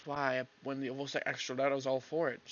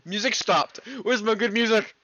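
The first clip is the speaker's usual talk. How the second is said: mock outrage; 9 semitones higher, 12 dB louder than usual. Real, not acted. Complaining music stopped.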